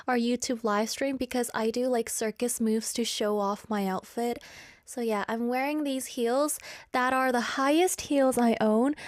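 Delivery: usual speaking voice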